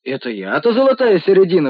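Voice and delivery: loud indignant protest. Acted. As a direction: bristle with outrage at the idea. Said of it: "возмутился синяя борода". (возмущённо, громко)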